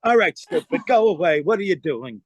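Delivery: lightly imitated brash comic; put-on insult-comic brush-off; Andrew Dice Clay. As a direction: dismiss the lackey with mock star arrogance. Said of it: "it's me playing Dice".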